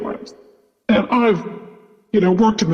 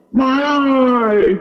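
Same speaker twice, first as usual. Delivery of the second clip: retold crying scream; 5 semitones higher than usual. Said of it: "almost crying, like, screaming".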